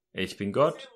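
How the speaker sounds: a hint of a calm voice from above; God. The voice reveals itself to the man, lightly voiced and low. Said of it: "die Stimme sagt".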